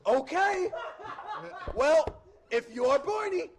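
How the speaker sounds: put-on high, loud, challenging Boston kid; thick Boston accent. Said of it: "talks with a thick Boston accent".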